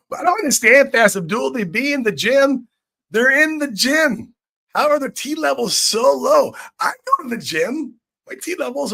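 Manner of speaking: high, loud mocking whine; mock whiny gym bro. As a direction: squeak it out like a baffled gym bro. Mocking gym guys' complaint.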